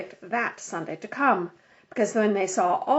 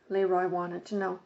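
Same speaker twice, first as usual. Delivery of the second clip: plain reading voice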